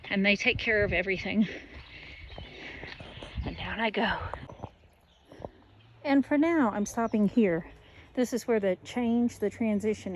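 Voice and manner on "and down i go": whispering. Real, not acted. Quiet aside while heading down.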